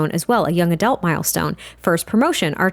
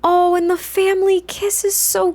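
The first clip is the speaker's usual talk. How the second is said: high, drawn-out coo; gushing adoration; 9 semitones higher, slower than usual. Real, not acted. Player watching the family kiss, gushing adoration. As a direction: coo over something adorable, stretching the words.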